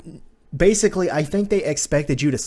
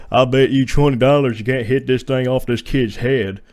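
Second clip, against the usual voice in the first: put-on loud country-boy voice, on an even pitch; mock him with a loud countrified twang; Wesker; 4 dB louder than usual. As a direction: imitate an uncool country guy, projecting. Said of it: "what do you think his voice sounds like?".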